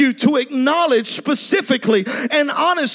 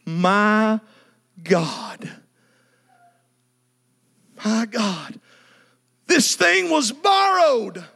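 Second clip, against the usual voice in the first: low, hushed dismay; stunned prophet realizing the loss; 5 semitones lower, much slower than usual. Acted. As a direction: gasp it out as the loss sinks in. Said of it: "And he says these words".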